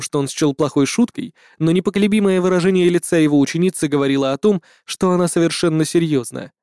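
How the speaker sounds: usual speaking voice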